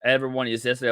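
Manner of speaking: ordinary delivery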